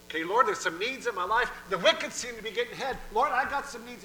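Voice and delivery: high, loud, urgent pleading; complaining to God. Acted. Worried believer telling the Lord of needs, agitated plea. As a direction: plead your case anxiously.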